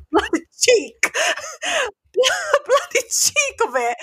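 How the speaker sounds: high, slow words through real laughter; laughing outrage. Real, not acted. Laughing to her friend about the cheeky guy. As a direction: laugh it out in disbelief.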